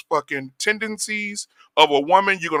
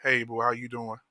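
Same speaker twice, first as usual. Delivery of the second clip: mock smooth; 5 semitones lower, 11 dB quieter than usual. Mocking men's soft old approach.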